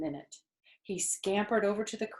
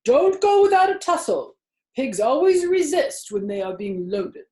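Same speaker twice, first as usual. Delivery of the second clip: put-on louder, higher old sheep urging; urgent old sheep; 10 semitones higher, 9 dB louder than usual. A narrator's reading. Old sheep urging Wilbur to struggle, loud and urgent. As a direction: press the pig to fight.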